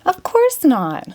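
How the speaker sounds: Ginger. Acted. Ginger answers the magician.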